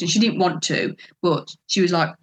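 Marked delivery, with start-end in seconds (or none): none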